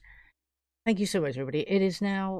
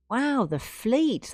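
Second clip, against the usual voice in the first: awed; 6 semitones higher, much slower than usual. Real, not acted. Marvelling at big gift.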